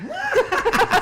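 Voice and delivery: high laugh. Real, not acted. Laughing at the Oz joke.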